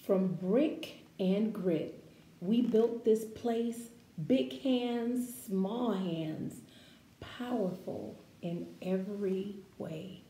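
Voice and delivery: slow, expressive picture-book read-aloud; reading to children. Acted. Teacher reading a picture book to her class, expressive read-aloud.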